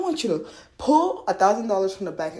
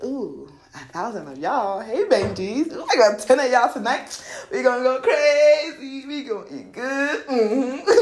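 hyped-up cheer building higher and louder; playful giddy hype; gleeful celebration; 6 semitones higher, 5 dB louder than usual. Acted. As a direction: greet them like a party crew, stretching words out. Acts out greeting her money.

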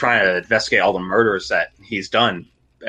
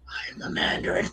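breathy whispered threat; 10 dB quieter than usual. Retold or imitated. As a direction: whisper it into his ear like a villain. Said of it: "He whispers in his ear".

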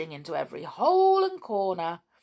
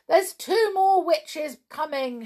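high, loud warning call; shouting; 8 semitones higher, 5 dB louder than usual. A narrator's reading. Pixies point out more witches, shouting. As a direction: shout the alarm, pitched up. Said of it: "shouted the pixies".

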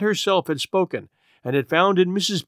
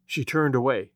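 usual speaking voice

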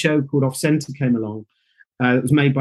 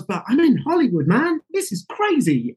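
put-on a kid overwhelmed by Hollywood; giddy; 8 semitones higher than usual. Young actor arriving in Hollywood, giddy and higher. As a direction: pitch jumping up, giddy awe.

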